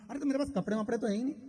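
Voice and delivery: fast flustered protest; rushed protest. Acted. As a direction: rattle off the excuse. Friend protests having no clothes.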